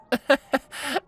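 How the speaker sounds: small, weak high chuckle. Acted. Tukey weakly chuckles at Diana's advice. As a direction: laugh weakly, a little awkward. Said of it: "Tukey chuckled weakly".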